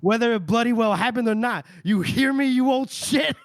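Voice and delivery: high, loud retold dressing-down; angry mock tirade. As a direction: build to an acted furious demand. Character grabs him, angry tirade.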